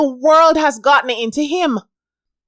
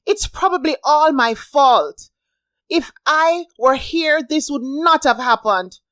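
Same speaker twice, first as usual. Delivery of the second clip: a higher, louder, distressed outcry; upset outcry; 6 semitones higher, 5 dB louder than usual. A narrator's reading. Molly crying out in alarm to her friend, upset. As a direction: blame herself in alarm. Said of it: "Molly cried".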